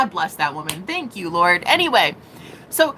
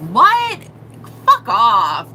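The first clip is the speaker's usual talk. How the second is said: loud, incredulous what then fuck off; indignant outburst; 6 dB louder, much slower than usual. Real, not acted. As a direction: shoot the thought down, loud and offended. Indignant rejection of the lie.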